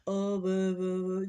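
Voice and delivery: loud, babbling mockery of a confused man; hammed-up gibberish, played as an act; mock crazy babble. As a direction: blurt nonsense like he's lost his mind. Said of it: "started talking like he was out of his mind".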